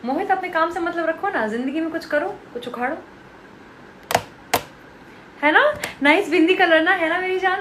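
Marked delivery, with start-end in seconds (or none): 5.4-7.6 loud, high teasing coo; playful gushing. Real, not acted. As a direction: fawn over the viewer playfully. Teasing viewers on live stream.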